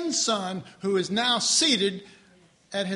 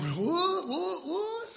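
high, comic bewildered repeats; baffled brain; 9 semitones higher, much slower than usual. Retold or imitated. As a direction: voice a brain that cannot keep up. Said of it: "my brain was going".

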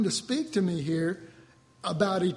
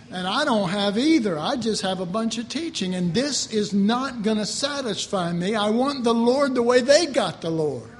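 louder, fervent resolve; determined vow; 6 dB louder than usual. Retold or imitated. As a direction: swear it with full force. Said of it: "I said".

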